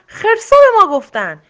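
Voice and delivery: high, loud growled answer; tigers' growl. A narrator's reading. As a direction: growl the reply at the lion. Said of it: "ببرها با غرش گفتن".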